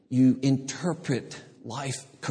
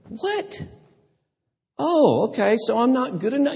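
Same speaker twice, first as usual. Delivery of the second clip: high, indignant and fast, mock offended; hurt woman; 13 semitones higher, 5 dB louder than usual. The Canaanite woman reacting to Jesus, mock offended. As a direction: squeak out a wounded retort.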